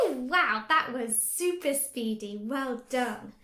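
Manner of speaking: loud delighted praise; big excited well done. Acted. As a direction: cheer the child on. Praising child's speedy sounds.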